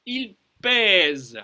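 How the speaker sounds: loud PÈSE with a long open "eh". Shown as a demonstration. Teacher warning about phonetics, emphatic open vowel. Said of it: "attention à la phonétique".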